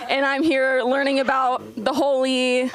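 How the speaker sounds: ordinary talk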